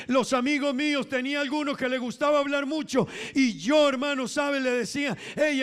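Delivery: high, driving sermon voice; full-force testimony to the congregation; fervent preaching. Real, not acted.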